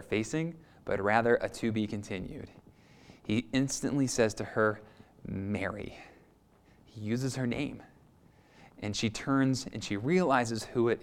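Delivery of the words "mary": 1 tender call. Acted. Jesus calls her name.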